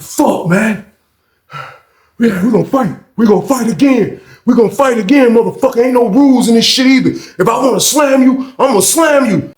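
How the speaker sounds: heated, insistent mock rematch demand; acted defiant threat. Acts Carl demanding a rematch.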